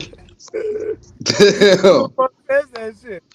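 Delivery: loud, slow outraged question. Retold or imitated. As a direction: demand it loudly, slowly. Outraged retelling, much louder.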